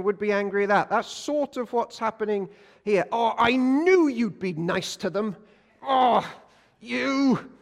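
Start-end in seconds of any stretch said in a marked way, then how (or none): 3.1-7.5 sulky Jonah. Pretend. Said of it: "Jonah complaining to God".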